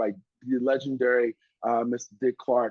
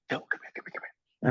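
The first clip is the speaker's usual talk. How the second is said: urgent whisper, softer, calling someone over; hushed beckoning; 8 dB quieter, faster than usual. Retold or imitated. Stage manager quietly summons the warm-up comic, urgent whisper. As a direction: whisper it fast and secretive.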